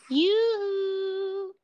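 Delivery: high sing-song call; sing-song yoo-hoo. Pretend.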